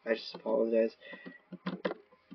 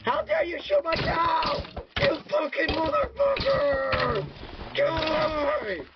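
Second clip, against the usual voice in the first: higher, slow, hammed-up fury; mock outrage; slower than usual. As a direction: rant in hammed-up indignation at the killer. Cow shot, mock outrage.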